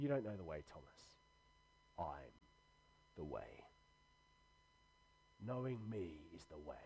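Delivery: soft words of Jesus to Thomas; hushed, intimate Jesus. Retold or imitated. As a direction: lean in and say it gently, almost breathless. Jesus voiced intimately, repeated softly.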